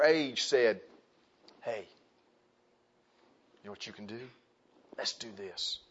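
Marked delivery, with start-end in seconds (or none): 1.6-5.8 low, hushed coaxing; soft conspiratorial invitation; sly peer pressure. Acted. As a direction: tempt a friend under your breath. Peers tempting a youngster, acted out.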